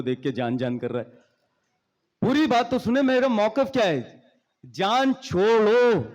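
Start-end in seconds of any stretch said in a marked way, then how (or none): 2.2-4.2 mock protest. Acted protest after being hit. 4.7-6.0 जान छोड़ो slow, both words stretched, higher pitch; drawn-out plea. Shown as a demonstration. Speaker explaining his case, जान छोड़ो slowly drawn out. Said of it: "एक होता है जान छोड़ो".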